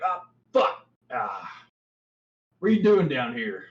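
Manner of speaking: bugbear voice. Acted. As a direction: caught off guard, spits it out.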